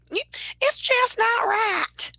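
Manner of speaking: loud, high Southern drawl; hammed-up Southerner calling out an injustice, slowly; Southern accent. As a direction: stretch it out in a high, mock Southern shout. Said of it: "have to have a Southern accent".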